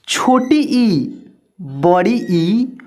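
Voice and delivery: छोटी ई and बड़ी ई stretched very slowly; drawn-out vowel names. Shown as a demonstration. Teacher reciting vowels to kids, slow and drawn out. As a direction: sing out each vowel name slowly for children. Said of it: "आ".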